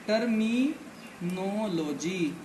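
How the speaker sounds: Terminology stretched out very slowly, syllable by syllable; slow, drawn out. Shown as a demonstration. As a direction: draw out terminology slowly so students catch every syllable. (Terminology को बहुत धीरे, खींचकर बोलना)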